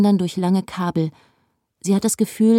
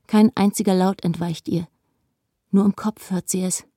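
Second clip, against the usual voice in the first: plain reading voice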